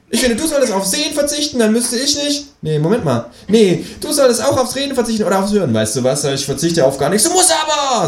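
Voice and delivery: high kangaroo voice tangling itself up; flustered kangaroo. Acted. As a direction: babble it high and muddled. Kangaroo squabble.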